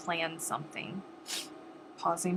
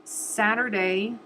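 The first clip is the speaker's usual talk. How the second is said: Saturday drawn out slowly with a rising end; stressed Saturday; 4 dB louder, slower than usual. Real, not acted. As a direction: stretch out Saturday and lift it at the end. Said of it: "this Saturday".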